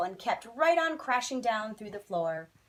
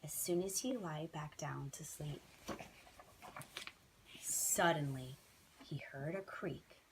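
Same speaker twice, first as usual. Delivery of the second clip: hushed suspense whisper; 6 semitones lower, 10 dB quieter than usual. A narrator's reading.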